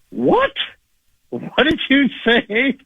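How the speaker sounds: high, slow disbelieving what did you say; incredulous what. Retold or imitated. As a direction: stretch it out in shock. Disbelieving retort to stranger.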